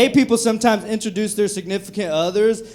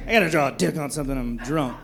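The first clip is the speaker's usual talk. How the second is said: put-on drunk mumble; 5 semitones lower, 7 dB quieter than usual. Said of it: "drunk college kid voiced".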